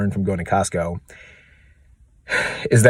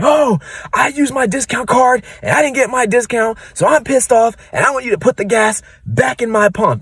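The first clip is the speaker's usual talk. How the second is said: high, whiny mock complaint; freaking-out customer; 14 semitones higher than usual. Irate customer demanding a refund from the gas station clerk, freaking out. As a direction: put on a shrill, outraged customer. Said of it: "she's freaking out".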